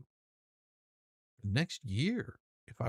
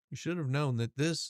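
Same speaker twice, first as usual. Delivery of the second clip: ordinary talk, nothing marked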